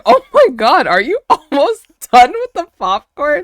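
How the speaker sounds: high, loud astonishment through laughter; amused disbelief. Retold or imitated. Cousin seeing the popcorn nearly gone, laughing disbelief. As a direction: exclaim it high, cracking up midway.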